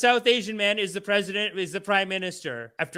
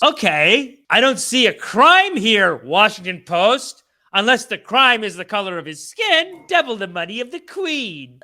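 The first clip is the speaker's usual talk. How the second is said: indignant rant; 3 semitones higher, 8 dB louder than usual. Real, not acted. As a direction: lecture the paper with mock outrage. Berating the newspaper.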